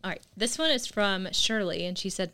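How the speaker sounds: usual speaking voice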